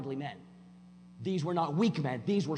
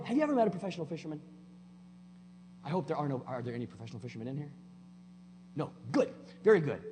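1 fast joking aside; faster than usual. Real, not acted. Quick joking aside to crowd.

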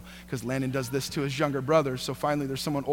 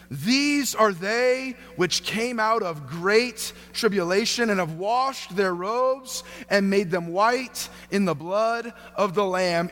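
slow, high, declamatory Bible reading; slow pulpit proclamation; proclaimed scripture; 5 semitones higher, slower than usual. Acted. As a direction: read the verse out grandly, stretching each phrase. Reads scripture aloud.

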